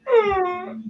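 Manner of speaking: high-pitched mock "ugh"; dismayed princess groan. Princess hears the orc talk back to a dragon, dismayed. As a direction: squeak out a dismayed little groan. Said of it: "her face drop, like".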